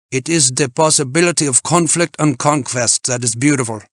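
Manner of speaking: German climber laying down the law; assertive German climber. A narrator's reading. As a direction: pronounce it as German dogma. Freitag's German character voice, pronouncing.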